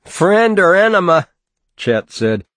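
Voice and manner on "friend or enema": taunting. A narrator's reading. Chet taunting pinned Bruce.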